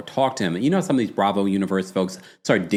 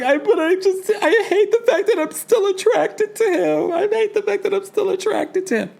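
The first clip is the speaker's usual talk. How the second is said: much higher whiny mock voice; mock whiny Alexia. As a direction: ham up a torn, whining Alexia. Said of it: "Alexia's like".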